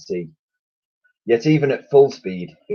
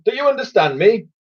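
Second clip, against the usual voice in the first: high pompous admiral rant; blustering admiral; 10 semitones higher, 4 dB louder than usual. A narrator's reading. Admiral keeps ranting.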